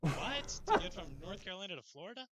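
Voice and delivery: high, loud disbelief; What, really?; surprised. Real, not acted. Shocked at nine-hour flights.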